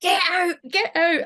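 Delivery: high, loud cry of get out; retold scream. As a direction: shout it out like purging the pain. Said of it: "I was screaming into the bucket".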